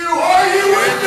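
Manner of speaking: loud rising rally cry; shouted call to crowd. Real, not acted. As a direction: roar it out to the crowd.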